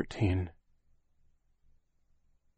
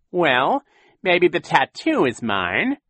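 higher, slow sly villain; grudging, oily villain admission; Count Olaf voice; 8 semitones higher, 8 dB louder, slower than usual. A narrator's reading. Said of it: "Count Olaf admitted".

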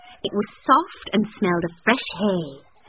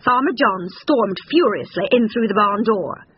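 usual speaking voice

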